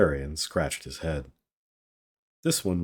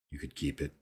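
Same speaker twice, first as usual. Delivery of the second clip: usual speaking voice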